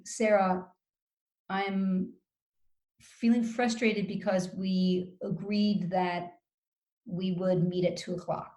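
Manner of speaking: very slow, measured, with pauses; unhurried, calm delivery in short phrases. Shown as a demonstration. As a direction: spell out frustration slowly and without sarcasm. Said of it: "speak to you very slowly without that sarcasm".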